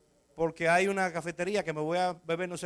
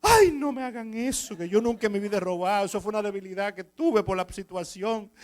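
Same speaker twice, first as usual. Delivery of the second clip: imitating a crying thief; 3 semitones higher, 4 dB louder than usual. Caught thief begging the shop owner, mock crying plea. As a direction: beg for mercy with a put-on sob. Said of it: "un hombre dando tanto grito".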